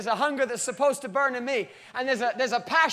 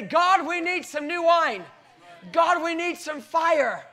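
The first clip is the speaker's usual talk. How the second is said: higher, slow, louder plea; crying out to God; 6 semitones higher, 3 dB louder, slower than usual. Retold or imitated. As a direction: call up to heaven with hunger. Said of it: "the body of Christ worldwide and say".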